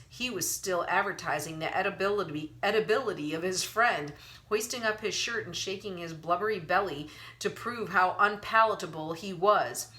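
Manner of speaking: usual speaking voice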